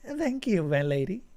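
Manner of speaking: high, grateful thanks; the Vestant, humbly grateful; deep gratitude. Acted. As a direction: thank her master with real gratitude. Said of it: "voice conveyed deep gratitude".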